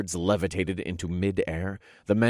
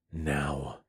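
lower, soft command; under the breath; 4 semitones lower, 6 dB quieter than usual. Acted. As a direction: quietly press the order. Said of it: "I ordered under my breath".

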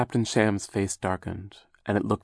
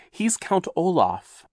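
higher, louder, slow insistence; impatient; 10 semitones higher, 5 dB louder, slower than usual. Acted. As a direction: press it home, fed up. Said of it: "Violet said impatiently".

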